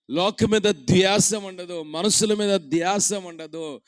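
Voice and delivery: loud, slow, over music; fervent preaching. Acted. Preacher over music telling listeners what fades, loud and slow. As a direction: beat out each repeated phrase.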